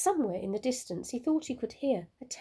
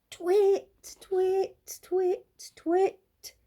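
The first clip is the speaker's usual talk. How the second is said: high, louder owl calls; owl twit calls; 10 semitones higher, 5 dB louder, much slower than usual. A narrator's reading. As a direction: keep chirping twit like an owl. Olive twitting over and over.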